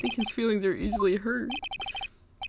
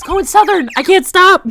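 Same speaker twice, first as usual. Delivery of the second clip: loud, higher, laughing; laughing shout; 8 semitones higher, 12 dB louder than usual. Real, not acted. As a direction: yell it while cracking up. Cracking up at own accent.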